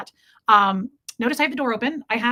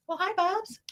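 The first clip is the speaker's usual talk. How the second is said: high, soft cooing to the cat; pet talk; 9 semitones higher, 5 dB quieter, slower than usual. Real, not acted. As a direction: coo a little hello to your pet. Greeting her cat mid-stream.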